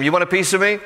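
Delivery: a playful first jab of a taunt; mock taunt. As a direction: throw out the dare like a bully. Preacher voices Goliath taunting.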